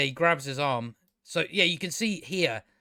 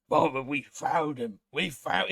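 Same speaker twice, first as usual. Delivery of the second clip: mimicking an orc's overwrought delivery; mock orc in rapture; orc impression. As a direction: put on a comic orc voice, overcome with ecstasy. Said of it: "the way this orc speaks".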